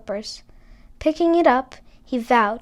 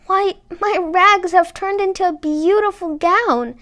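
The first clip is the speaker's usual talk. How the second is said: high, louder amazed cry; exclaiming in delight; 7 semitones higher, 6 dB louder than usual. A narrator's reading. Cinderella amazed at her rags turned into a gown. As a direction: gasp with joy at the new gown. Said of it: "exclaimed Cinderella".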